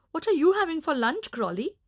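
hungry puppy asking the donkey; eager puppy voice. Acted. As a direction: rattle it off like an eager pup. Puppy asks caterpillar.

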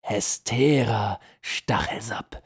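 very slow, half-whispered relish; savouring the name. A narrator's reading. General relishes the witch's name, slowly savouring it. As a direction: let each word melt on the tongue. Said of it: "Er ließ sich den Namen auf der Zunge zergehen".

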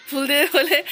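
laughing through the words; giggling as she speaks. Real, not acted. Laughing at mother's flower plan.

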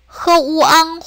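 흐우앙 split into 흐, 우, 앙; level, separated syllables in 흐우앙; sounded out separately. Shown as a demonstration. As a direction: break 흐우앙 apart for learners. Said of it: "우리 이것도 분리해서".

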